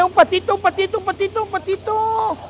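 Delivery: high playful sing-song; sing-song calling. Real, not acted. Handing out toy ducks to kids.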